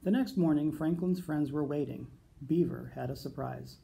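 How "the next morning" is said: ordinary delivery, nothing marked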